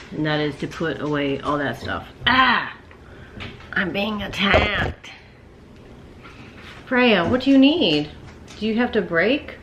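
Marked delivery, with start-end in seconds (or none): 2.3-2.7 startled yelp. Real, not acted. Dog pounces on her. 3.7-9.6 playful alarm. Real, not acted. Playfully addressing the dog.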